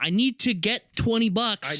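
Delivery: high, pleading whine; whiny beggar. Pretend. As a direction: beg for cash like a broke buddy. Begging friend for twenty bucks.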